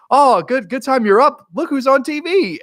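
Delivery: high, loud sing-out welcome; mock cheery greeting. Killer to captive waking up, mock cheery high voice. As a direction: chirp it high like a delighted host.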